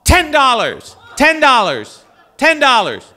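high, loud repeated bid call, laughing at the end; shouting a bid. Retold or imitated. Priest shouts his ten-dollar bid down the phone, laughing. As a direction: bellow the bid like at an auction.

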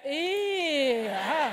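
long, squeezed ew, then a dropping ah; disgusted ew. Shown as a demonstration. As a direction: demonstrate the wrong, grossed-out reaction. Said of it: "Do not look at them and go".

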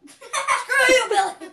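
loud, high, through laughter; laughing insult. Pretend. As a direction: crack up while cursing him playfully. Laughing mock insult at Billy.

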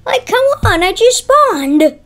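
high, loud complaint, drawn out at the end; exasperated protest. Real, not acted. Player killed right after spawning complains, exasperated. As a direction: whine loudly that it's unfair.

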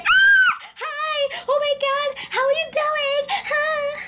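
very high, squealing fan impression; mock starstruck fan. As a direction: shriek a greeting like a gushing fan. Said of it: "one of those famous YouTubers, they would be like".

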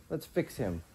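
usual speaking voice

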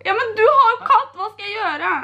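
mock crying; fake tearful panic. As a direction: beg dad for help, pretending to cry. Fakes tearful panic to dad.

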